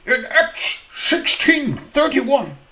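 stern preacher's retort; glaring retort. Acted. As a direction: snap back with scripture like a stern doctor. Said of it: "he glares at them".

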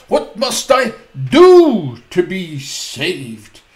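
very slow and loud, each word weighed; slow, loud emphasis; emphatic quote. Shown as a demonstration. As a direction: drive home the question slowly. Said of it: "Did you hear that?".

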